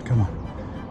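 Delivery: ordinary delivery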